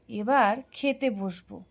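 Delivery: usual speaking voice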